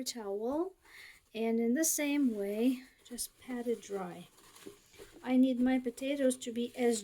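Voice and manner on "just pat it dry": soft whisper, almost to herself. Real, not acted. Cooking vlogger whispering to herself while patting potatoes dry. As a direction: breathe the words out quietly.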